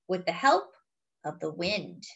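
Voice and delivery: usual speaking voice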